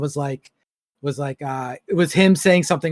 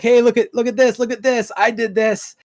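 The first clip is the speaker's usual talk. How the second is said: mock bragging; 5 semitones higher than usual. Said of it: "to be like".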